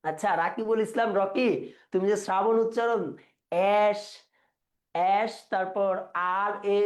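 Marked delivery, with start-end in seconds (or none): none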